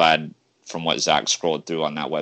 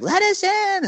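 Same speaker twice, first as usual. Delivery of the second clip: very high mock crowd yell; hammed-up high-pitched shout; imitated pleading fans; 4 dB louder, slower than usual. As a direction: mimic a locked-out fan begging to get in. Imitates locked-out fans at arena.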